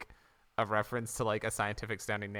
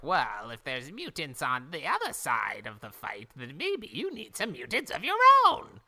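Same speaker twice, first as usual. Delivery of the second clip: Krang voice; 2 semitones higher, 5 dB louder than usual. Retold or imitated. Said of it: "Krang gave him the idea of, like".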